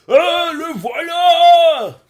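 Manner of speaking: high, loud, drawn-out cartoon shout; cowboy's shout. Villager announcing the monster's arrival, with a high, slow, loud shout. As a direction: yell it slowly across the village. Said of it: "qui crie ça".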